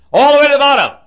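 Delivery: high, very loud outburst; emphatic shout. Real, not acted. Preacher stressing how far the pastor fell, emphatic shout. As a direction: holler it for emphasis.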